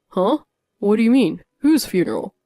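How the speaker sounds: confused, caught off guard; stunned husband. Acted. Husband shocked by news.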